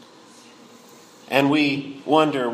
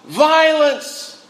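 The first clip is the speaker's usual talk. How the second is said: one high-pitched cry of alarm; 10 semitones higher, 4 dB louder than usual. Acted. Preacher voices Habakkuk protesting to God, crying out. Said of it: "He cries out".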